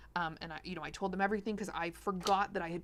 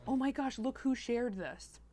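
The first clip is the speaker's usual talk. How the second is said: excited discovery; 6 semitones higher than usual. Retold or imitated. Group member telling others who shared it, excited. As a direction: gasp in delight at who shared it.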